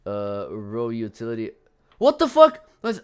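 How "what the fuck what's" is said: sudden high, loud startled cry; jump-scared yelp. Real, not acted. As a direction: yelp as something jumps out at you. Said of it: "this really jump scared me".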